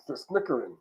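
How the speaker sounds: soft mock snicker from the back of the throat. Shown as a demonstration. Preacher showing the congregation how the schemers snicker, soft mock snicker. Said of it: "You know that snicker?".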